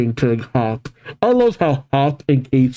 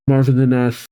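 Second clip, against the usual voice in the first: ordinary delivery, nothing marked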